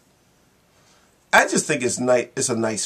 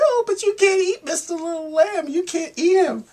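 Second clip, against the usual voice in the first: put-on very high, pleading child's voice; a tiny girl's squeaky plea; pleading little girl; 5 dB louder than usual. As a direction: beg the lion to spare the lamb. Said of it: "And the little girl said".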